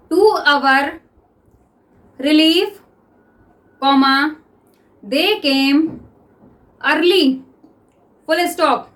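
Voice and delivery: teacher dictating to the class; dictation reading. Shown as a demonstration. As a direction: dictate word by word for students to write. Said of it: "okay, तो start".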